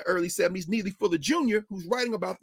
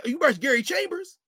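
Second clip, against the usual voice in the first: ordinary talk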